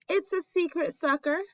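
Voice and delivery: a touch of pride, voice lifted high; proud. A narrator's reading. Albert showing his sister his gadget, proudly. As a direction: show off the invention proudly. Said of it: "Albert explained proudly".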